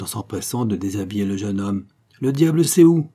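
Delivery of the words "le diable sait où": high, loud, bitter outburst; sombre outburst. A narrator's reading. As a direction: spit it out darkly, much louder. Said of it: "d'un air sombre".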